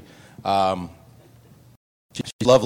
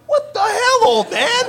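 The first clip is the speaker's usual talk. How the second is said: put-on much higher, indignant yelp at the old man; outraged what the hell; 4 dB louder, slower than usual. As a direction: squeal it in comic outrage. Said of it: "I go".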